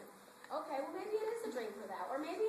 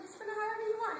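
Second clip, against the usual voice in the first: upbeat offer; 9 semitones higher, 5 dB louder, faster than usual. Retold or imitated. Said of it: "And I said".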